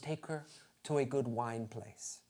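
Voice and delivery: soft mock Belgian accent; [name]. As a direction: softly advise in a bad Belgian accent. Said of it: "that's not a Belgian accent".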